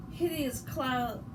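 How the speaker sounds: usual speaking voice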